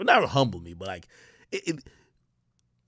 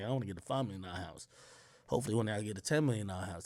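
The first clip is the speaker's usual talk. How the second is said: quiet daydream; 4 semitones lower, 11 dB quieter, faster than usual. Retold or imitated. Quoting his own dreaming.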